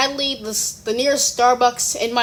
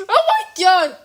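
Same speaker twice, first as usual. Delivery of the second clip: higher, laughing through it; 7 semitones higher than usual. Real, not acted. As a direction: say it mid-laugh. Laughing at the chaos.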